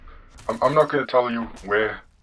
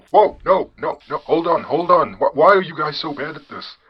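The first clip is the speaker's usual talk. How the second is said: higher-pitched, incredulous protest; exasperated disbelief; 5 semitones higher than usual. Acted. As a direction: plead with them in sheer exasperation. Host protests Institute's incompetence.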